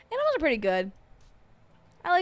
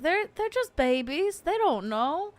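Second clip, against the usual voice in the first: lightly raised cooing; mock baby talk; 8 semitones higher than usual. Owners looking at their dogs, lightly cooing over them. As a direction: coo about the dogs as if they're babies. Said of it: "we'll be like".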